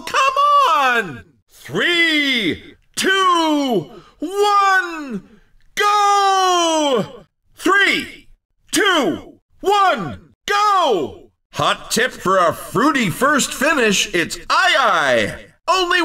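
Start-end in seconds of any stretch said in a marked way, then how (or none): 0.0-1.2 high, drawn-out commentator shout; high-pitched call to the track; exasperated urging. Acted. Urging slow racers at start. 1.5-7.2 slow countdown climbing to a high, held GO; big drawn-out starter's call; race countdown. Acted. 7.6-11.3 high, punchy starter's count; announcer's countdown with a high GO; race countdown. Acted. Race start countdown, second take.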